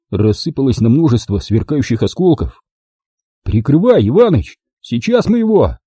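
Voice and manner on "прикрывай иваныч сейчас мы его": high, urgent call to a comrade. A narrator's reading. Driver calls for cover under fire, shouting. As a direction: shout the order, pitch climbing.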